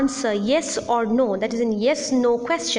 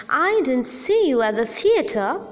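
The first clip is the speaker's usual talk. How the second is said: fall-rise on "see", hinting at more. Shown as a demonstration. Teacher demonstrating a fall-rise with implication. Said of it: "falling rising tone".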